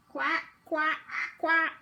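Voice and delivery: duck quacks. Shown as a demonstration. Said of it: "I bet you've seen these before".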